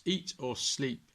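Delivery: ordinary delivery, nothing marked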